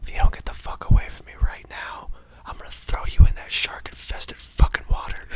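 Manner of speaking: put-on hissed threat in a boy's ear; whispered threat to a child; menacing whisper. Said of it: "I'm gonna whisper something in his ear".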